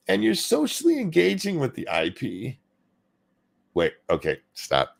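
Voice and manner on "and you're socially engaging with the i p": higher mock crying read. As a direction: whine it like you're about to cry. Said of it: "I was gonna keep doing the crying voice".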